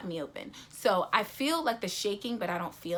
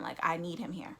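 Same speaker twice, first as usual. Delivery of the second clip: panicked whisper; 3 semitones lower, 6 dB quieter than usual. Retold or imitated. Panicking alone.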